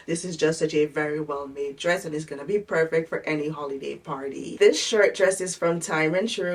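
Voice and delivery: ordinary talk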